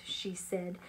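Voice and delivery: plain reading voice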